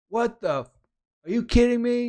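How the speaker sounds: high-pitched mock shriek of disbelief; hammed-up high voice, incredulous; outraged wife. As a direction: imitate a stunned wife yelling from the background. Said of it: "you hear in the background from his wife".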